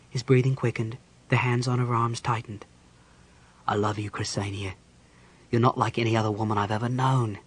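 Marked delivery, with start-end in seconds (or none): 3.7-7.4 soft, low declaration of love; tender confession. A narrator's reading. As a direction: confess love quietly, voice lowered. Caramon confesses his love.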